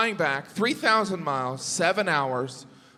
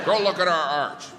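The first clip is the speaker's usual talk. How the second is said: pushy local booster; 4 dB louder than usual. Retold or imitated.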